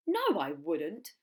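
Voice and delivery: loud, slow no; firm refusal. Acted. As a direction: drag the no out firmly. Not-so-big croc refuses.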